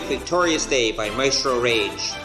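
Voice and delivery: ordinary delivery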